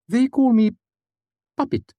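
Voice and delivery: put-on high, muffled odd old man; an oddball asking from behind a door; eccentric old man. A narrator's reading. Puppet voiced.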